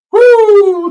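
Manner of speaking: very high, loud woo; high whoop; excited whoop. Real, not acted. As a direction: let out a whoop. Thrilling moment, live.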